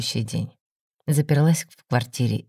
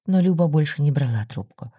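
ordinary delivery

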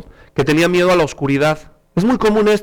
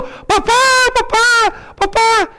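high-pitched imitated child's cries; desperate shouting child; much slower than usual. Child alone in darkness screams for papá, mock desperation. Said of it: "el niño estaba desesperado, gritando".

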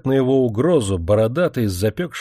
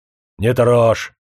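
slow, firm and louder; stern; 4 dB louder, much slower than usual. A narrator's reading. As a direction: stop him with a slow stern order. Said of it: "сурово сказал он".

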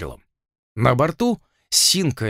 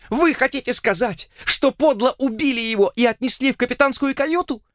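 high, tearful demanding voice; angry crying; 11 semitones higher than usual. A narrator's reading. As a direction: demand it through tears. Esteban demanding answers, distraught.

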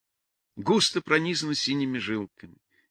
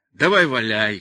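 gloomy retort; 3 semitones lower, 8 dB louder than usual. A narrator's reading. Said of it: "хмуро сказал Алтынник".

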